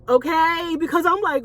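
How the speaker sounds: usual speaking voice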